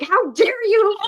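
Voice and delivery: high, loud outraged cry. Retold or imitated. Streamer reliving rage at Andy over the dogs, high loud outrage. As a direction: let the indignation burst out in a high yell. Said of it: "I was like".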